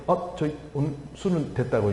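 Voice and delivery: mildly flinching, hesitant refusal; flustered hesitation. Retold or imitated. Young church member declining alcohol before the pastor, mildly flustered. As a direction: imitate someone hedging nervously. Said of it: "걔가 갑자기 움찔움찔하더니".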